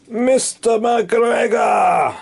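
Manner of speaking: high, slow shouted reveal; dramatic reveal. Acted. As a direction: cry it out in alarm. Reveal of the farmer.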